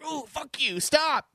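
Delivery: hammed-up furious shout at a cutscene; mock angry outburst. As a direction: snap at the game like it wronged you. Retelling rage at game intro.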